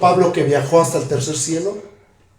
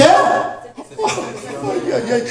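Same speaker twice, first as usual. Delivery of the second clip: laughing high then fast through the words; 6 semitones higher, slower than usual. Real, not acted. Preacher laughs reading the verse to the congregation, fast and laughing. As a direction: laugh it off in delight.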